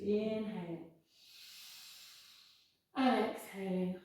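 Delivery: unhurried, calm guidance; careful unhurried voice. Acted. Guides breathing in cat cows.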